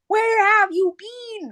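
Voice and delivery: high, drawn-out teapot wail; shrill-pitched, stretched-out fretting; fussing tea kettle. Retold or imitated. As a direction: scold like a worried cartoon teapot. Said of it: "she's like the tea kettle from Beauty and the Beast".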